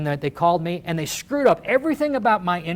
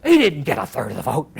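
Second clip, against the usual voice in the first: lightly voiced Baker; 4 semitones lower than usual. Governor denying the vote to reporters, lightly mocked. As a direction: brush it off like a cornered politician.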